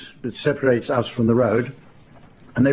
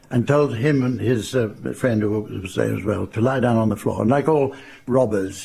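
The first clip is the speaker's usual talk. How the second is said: ordinary talk, nothing marked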